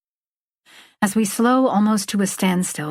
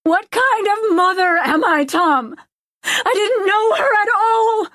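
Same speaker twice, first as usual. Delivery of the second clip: very high, wailing yell; high-pitched distraught outburst; anguished scream; 13 semitones higher, 4 dB louder than usual. A narrator's reading. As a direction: scream it out in despair. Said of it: "something I want to scream".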